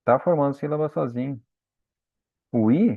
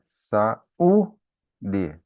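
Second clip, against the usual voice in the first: "Saúde" slowly broken as sa... Ú... de; stress on U. Shown as a demonstration. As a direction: lift the middle U of "Saúde", pauses between syllables. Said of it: "o U é a sílaba tônica". ("Saúde" em sílabas, força no U)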